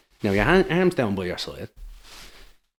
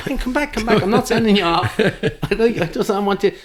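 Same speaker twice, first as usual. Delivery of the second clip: high, loud pleading call to come back; 13 semitones higher, 9 dB louder than usual. Retold or imitated. Referee calling linesman back across pitch, loud and pleading. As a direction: shout after him not to leave. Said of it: "He goes".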